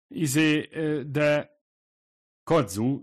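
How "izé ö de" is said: higher, louder halting "Izé, ö, de"; cornered and flustered; flustered stammer. A narrator's reading. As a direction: trip over the words. Flustered stammer to pushy guest.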